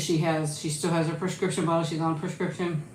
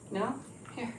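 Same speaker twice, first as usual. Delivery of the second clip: ordinary talk, nothing marked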